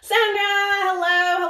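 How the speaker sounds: high, drawn-out singsong hello; high-pitched, elongated welcome; excited greeting. Real, not acted. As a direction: greet a friend with slow, stretched delight. Greeting a viewer by name.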